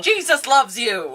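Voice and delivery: slow, high, hammed-up evangelist; mock faith healer. Co-host joins the healing joke, mock faith healer. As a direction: stretch it out like a preacher's blessing.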